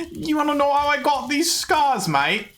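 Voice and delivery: a hammed-up cockney Joker; British Joker. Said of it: "I'm not good at accents".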